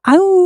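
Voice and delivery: short high wolf howl, held back; a little yelp of a howl. Pretend. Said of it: "Do the wolf noise".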